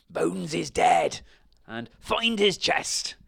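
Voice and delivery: high, loud, slow yells; pirate shouts. A narrator's reading. Pirates shouting while ransacking the inn. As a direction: bellow across the house. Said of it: "heard shouts of".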